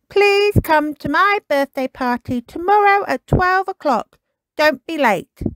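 high eager puppy voice reading out his words; proud little dog spelling out his invite; young Kipper, pleased with himself; Kipper writing his invitation. Acted. Kipper paints party invitation.

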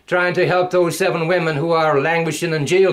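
ordinary talk, nothing marked

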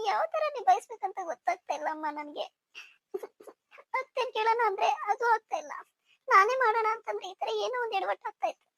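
quietly near tears, pausing between lines; tearful. Acted. Young bride alone after being scolded, soft and tearful. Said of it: "ನಿಸರ್ಗ ಅಳ್ತಾ ಇದ್ದಾಳೆ".

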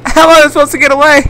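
high, frantic pleading shouts; being chased. Real, not acted. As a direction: yell at the chaser to back off. Fleeing a chasing zombie.

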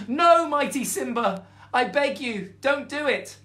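high, loud desperate mouse begging; pleading mouse. Acted. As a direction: beg the lion for your life, squeaking.

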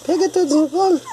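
very high cutesy voice. Real, not acted. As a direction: coo it way up high. Baby talk around the puppies. (voz fininha de falar com bichinho)

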